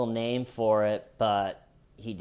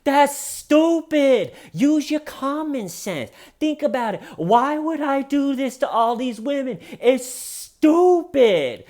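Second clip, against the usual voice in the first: mock dismissive outburst; 8 dB louder than usual. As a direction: sneer it in a squeaky parody voice. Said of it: "he responds to it by just going".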